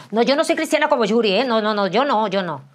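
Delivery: loud, fast mocking denial; hammed-up scoff at being like Yuri. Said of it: "mocking people ashamed of faith".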